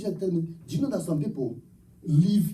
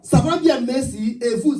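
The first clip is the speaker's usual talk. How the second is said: higher, louder, half-sung French phrase; mockery of tongues as one learned phrase; 7 semitones higher, 7 dB louder than usual. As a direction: chant rote French like fake tongues. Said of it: "Speak another one".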